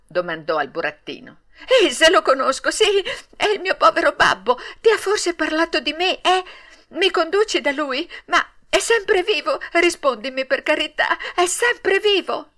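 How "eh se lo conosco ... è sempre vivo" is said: put-on high, breathless-with-hope child's questions; eager, high-pitched imploring; agitated pleading. A narrator's reading. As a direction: beg urgently for news of a father. Puppet begs news of his father.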